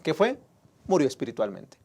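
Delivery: ordinary talk, nothing marked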